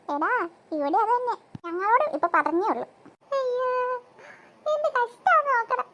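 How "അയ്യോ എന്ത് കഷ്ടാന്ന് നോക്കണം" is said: high, theatrical moan; lamenting.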